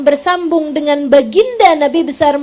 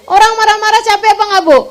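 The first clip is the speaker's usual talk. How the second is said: normal talking voice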